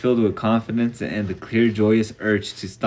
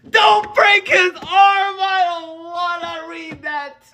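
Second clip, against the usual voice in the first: much higher, loud, slow shout; real alarmed shout; 4 dB louder, slower than usual. Real, not acted. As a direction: cry out in real dismay. Reader reacts to the story.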